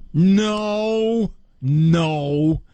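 long, drawn-out disapproving no; mock scolding. Host mimics experts correcting parents' wording, mock scolding. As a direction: stretch it out like an exasperated expert.

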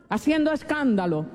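usual speaking voice

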